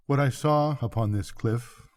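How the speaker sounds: usual speaking voice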